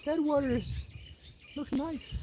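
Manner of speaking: very high, soft cat voice; coy little cat wanting the water; voicing the cat. Streamer voicing her cat eyeing the water, high and soft. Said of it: "She's like".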